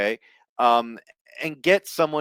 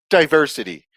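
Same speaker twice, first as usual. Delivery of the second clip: mock quote; 5 semitones higher, 9 dB louder than usual. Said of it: "and be like".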